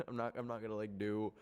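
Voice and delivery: ordinary delivery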